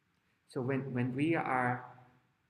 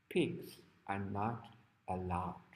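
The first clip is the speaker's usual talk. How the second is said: low, slow and softer; slow, low pronouncement with pauses; stern sign reading; 5 semitones lower, 6 dB quieter, slower than usual. Acted. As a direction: read a forbidding notice gravely. Reading out the principal's forbidding sign.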